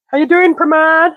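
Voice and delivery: high, delighted call-out; teasing a viewer, high and delighted; excited high greeting. Real, not acted.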